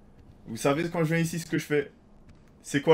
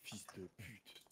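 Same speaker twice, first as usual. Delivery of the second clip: whispered insult; 9 semitones lower, 31 dB quieter, much slower than usual. Real, not acted. Hiding, swears under breath.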